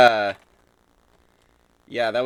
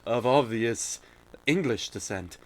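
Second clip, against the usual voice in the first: put-on posh English accent; 4 dB quieter than usual. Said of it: "the good guys are all like".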